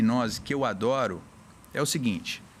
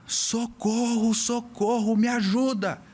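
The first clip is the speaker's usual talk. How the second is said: grito encenado, agudo e esticado; imitando grito de socorro; 7 semitones higher, slower than usual. Retold or imitated. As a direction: imitate a drawn-out, high-pitched cry for help. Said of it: "Essa pessoa começa a gritar". (grito de socorro imitado)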